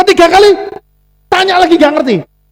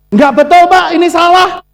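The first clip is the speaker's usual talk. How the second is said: loud, high, fed-up "ini salah"; irritated shout; 7 semitones higher, 4 dB louder than usual. Retold or imitated. Repeated bank questions, speaker shouts back fed up. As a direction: shout him down, done with the questions. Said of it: "Saya mangkal".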